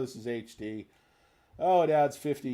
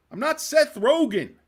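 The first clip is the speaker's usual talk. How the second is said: loud, higher, hammed-up protest; mock indignant shout; 13 semitones higher, 10 dB louder than usual. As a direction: deny it with fake outrage. Chat keeps calling him Seth Rogen.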